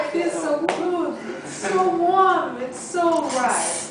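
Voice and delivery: put-on sultry French accent. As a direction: purr it like a French seductress. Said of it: "She had a French accent before".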